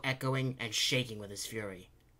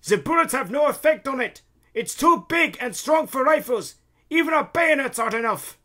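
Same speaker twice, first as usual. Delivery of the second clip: very high, loud soldier's yell; calling out; 14 semitones higher, 12 dB louder than usual. A narrator's reading. Soldier reporting useless bullets to Randolph, yelling. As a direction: shout the bad news over battle. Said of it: "One of the soldiers called to Randolph".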